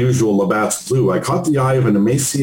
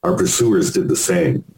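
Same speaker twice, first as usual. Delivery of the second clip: ordinary delivery, nothing marked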